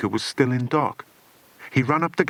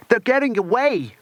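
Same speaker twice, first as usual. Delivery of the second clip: high alarmed shout; cried out; 11 semitones higher, 4 dB louder than usual. A narrator's reading. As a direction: cry out as they escape. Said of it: "cried Lucas".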